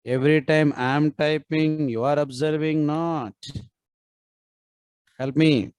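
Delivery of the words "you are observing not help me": ordinary talk, nothing marked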